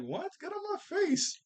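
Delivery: high-pitched irritated brush-off; squeaky, exasperated mock rejection; mock indignation. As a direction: act out shooing an unwanted dance partner away. Acting out Oric rejecting Sissy.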